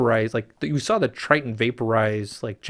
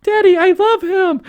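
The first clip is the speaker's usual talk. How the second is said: put-on very high, loud girlish declaration; high pleading mermaid girl to her father; Ariel voice; 8 dB louder than usual. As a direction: falsetto daughter confessing love.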